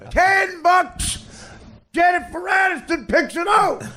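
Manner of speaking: very high, hollered wager; shouted bet. Acted. Rowdy fans bet on celebrities at the table, gleefully shouting. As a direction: yell it out like a rowdy caddy.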